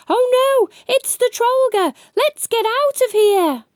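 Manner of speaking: high, loud panicked cry; shouted. Acted. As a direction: yell it as the monster appears.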